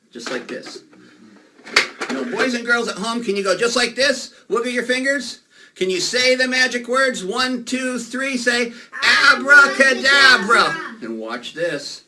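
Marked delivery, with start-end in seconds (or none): 2.1-8.7 put-on high, loud children's show host; rally the kids at home; kids party magician. 9.3-11.0 slow, loud Abracadabra; shouted magic word. Acted.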